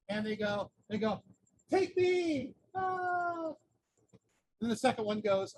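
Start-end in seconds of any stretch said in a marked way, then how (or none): 1.7-2.5 higher, louder cry to the wind; calling out. Retold or imitated. Sister calls out from mountain peak. 2.8-3.5 short high cry of a fall; mock scream as she drops. Sister falls from the peak.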